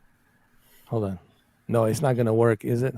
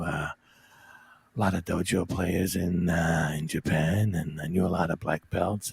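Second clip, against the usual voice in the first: Steven Seagal; 3 semitones lower, 5 dB quieter than usual. Acted. Guest asked for Seagal's opinion of an actor, low murmured impression. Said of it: "in a Steven Seagal voice?".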